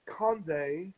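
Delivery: put-on light British accent on the name. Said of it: "Not in the accent".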